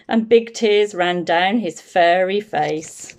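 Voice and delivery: ordinary delivery, nothing marked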